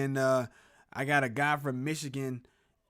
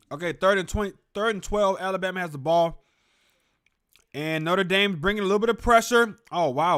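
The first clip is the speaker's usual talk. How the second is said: excited play-by-play; 3 semitones higher, 9 dB louder than usual. Real, not acted. Live play calling.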